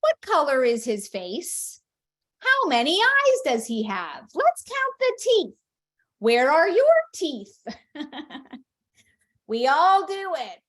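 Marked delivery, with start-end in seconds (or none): 0.0-7.6 high, loud mock quiz voice; hammed-up over-eager parent testing a child; quizzing parent. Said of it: "Testing would be". 7.9-8.6 soft laugh at oneself; brief self-amused giggle; quiet chuckle after the joke; small chuckle. Real, not acted. Laughs at own parody.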